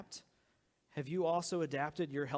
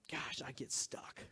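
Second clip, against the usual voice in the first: soft unvoiced confession; whispered aside; 4 semitones lower, 8 dB quieter than usual. Real, not acted. Pastor confiding to congregation, whispered. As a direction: breathe it out as a private admission.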